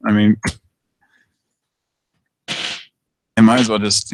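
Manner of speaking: ordinary talk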